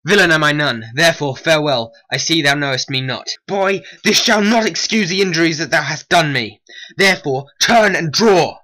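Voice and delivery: loud duel challenge; angry challenge. Acted. Tybalt challenges Romeo to duel.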